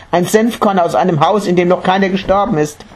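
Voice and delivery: high, hurried recital of the errand; eager repeating. A narrator's reading. Mother memorising her errand as she runs, eager.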